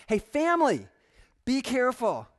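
loud, high, urgent call; calling out a warning. Acted. Preacher warns brothers and sisters as family, calling out. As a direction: grab the family's attention.

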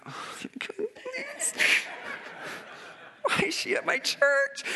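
high, squeaky tearful whine; hammed-up crying complaint; mock despair. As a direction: wail it like a comic meltdown. Acts out despair at home.

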